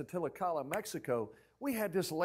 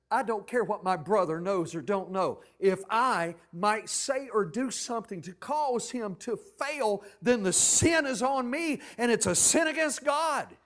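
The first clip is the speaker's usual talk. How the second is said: loud scripture paraphrase, building in force; forceful preaching; 5 semitones higher, 9 dB louder, slower than usual. Real, not acted. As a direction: drive it home, louder toward the end.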